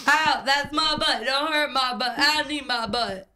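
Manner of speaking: put-on whiny childish complaint. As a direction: whine it like a hurt kid. Whiny mock pain.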